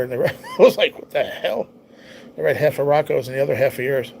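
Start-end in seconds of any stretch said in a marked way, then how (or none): 0.2-1.7 breaking into a laugh, pitched higher; amused confusion. Real, not acted. Streamer laughing at mixing up two chat messages, amused confusion. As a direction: laugh mid-word, then a higher, baffled question. Laughs at his own misreading.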